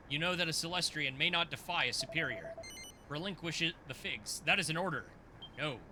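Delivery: low, solemn teacher voice; stern old mentor. Acted. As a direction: speak as a grave celestial master.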